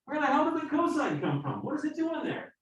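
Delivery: high-pitched, squeaky bewildered question; comically high voice, full of disbelief; baffled outburst. Acted. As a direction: ask it in a high mock-bewildered voice. Voicing a baffled student's question.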